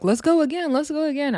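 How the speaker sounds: high, eager retold challenge; imitated challenger. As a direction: mimic someone itching for another round. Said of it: "Rolly is like".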